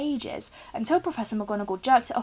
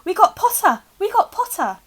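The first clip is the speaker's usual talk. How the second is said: much higher, louder triumphant shout; gleeful yell; 10 semitones higher, 8 dB louder than usual. A narrator's reading. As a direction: cheer it across the hall. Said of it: "the Weasley twins yelled".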